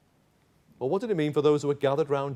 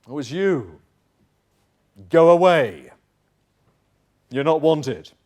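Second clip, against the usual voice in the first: curt, loud slam-the-door Go away; dismissive brush-off; 6 dB louder, slower than usual. Acted. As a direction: turn him away coldly at the door.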